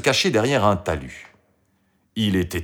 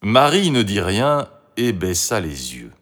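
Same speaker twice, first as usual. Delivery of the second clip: plain reading voice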